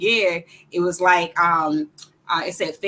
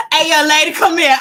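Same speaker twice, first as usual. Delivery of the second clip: loud, high mock summons; imitated cop call-out; 10 semitones higher, 11 dB louder than usual. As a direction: call her over like an officer, retold with excitement. Retold undercover cop calling her over.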